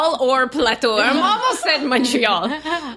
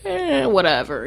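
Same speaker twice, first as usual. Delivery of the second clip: careless. Retold or imitated. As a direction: imitate someone who couldn't care less. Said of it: "he's careless or he's just like".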